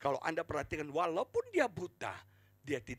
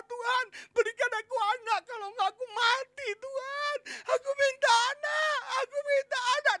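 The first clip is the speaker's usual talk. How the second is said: high, loud imitated drunken wail; crying, screaming plea; 10 dB louder than usual. Preacher as Hana begging God for a child, hammed-up crying scream. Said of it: "Dia nangis, sudah dia jerit".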